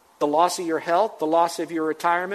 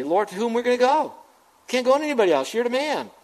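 higher-pitched paraphrase of a disciple's reply; emphatic Peter; 6 semitones higher, faster than usual. Retold or imitated. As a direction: answer as the disciple, pitched up and insistent. Acting out Peter's answer to Jesus.

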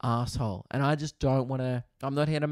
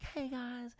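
high, soft sing-song greeting; sweet high little hello; imitating friendly Meg; 12 semitones higher, 10 dB quieter than usual. Meg greeting the neighbours in a high soft voice. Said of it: "She's like".

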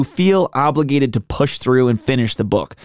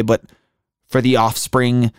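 ordinary talk

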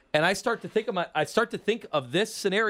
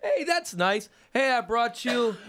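pleased new neighbor; 6 semitones higher, slower than usual. Acted. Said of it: "And I go".

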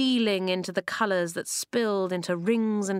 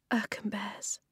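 tearful whisper; through tears; 6 dB quieter than usual. A narrator's reading. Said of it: "said through the tears".

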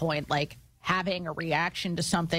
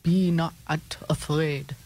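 Dracula voice. Pretend. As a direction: ham up a Transylvanian count. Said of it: "the vampire voice".

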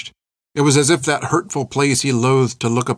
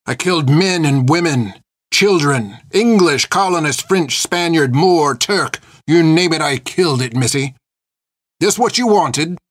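listing crimes in pitched-up, punched words; angry confession; 5 semitones higher, 3 dB louder than usual. Acted. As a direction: hurl each word at her like an accusation. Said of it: "unclenched visage of anger".

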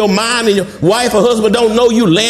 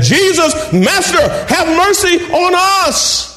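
crying out for mercy; 8 semitones higher than usual. Acted. Preacher voicing the lepers begging Jesus, desperate cry. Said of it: "lifted up their voices and said".

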